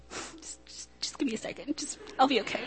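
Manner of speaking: faint, breathless, barely voiced, like through tears; tearful plea. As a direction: choke out the words while crying. Crying on the phone.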